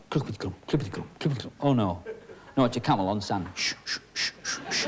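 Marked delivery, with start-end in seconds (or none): 0.1-1.5 hoof sound. Pretend. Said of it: "he came along going". 3.5-4.9 soft shushing hooves in the sand; camel on sand. Shown as a demonstration. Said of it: "Like this".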